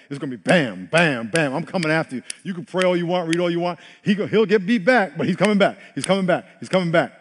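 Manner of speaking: building forceful warning. Pretend. Preacher warning the congregation that sin keeps coming back, forceful and loud. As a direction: hammer each bam like sin at the door. Sin personified knocking at door.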